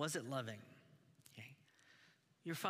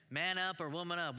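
tough father; 5 semitones higher, 5 dB louder than usual. Retold or imitated. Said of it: "our father's voice that says".

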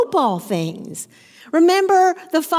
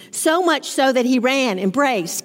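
normal talking voice

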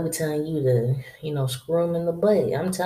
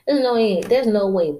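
emphatic disbelief; 5 semitones higher, 5 dB louder than usual. Real, not acted. Insisting the boyfriend can't protect.

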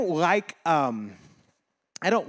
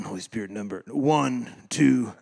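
ordinary delivery

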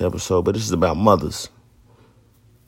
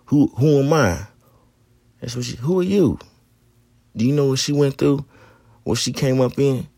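high, pointed scolding questions; aunt scolding him, voice raised high; auntie scolding; 6 semitones higher than usual. Retold or imitated. Aunt on the phone scolding nephew in prison, sharp high questions.

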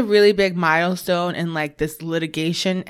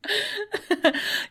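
high giggle; 5 dB quieter than usual. Real, not acted. Laughing at friend's joke.

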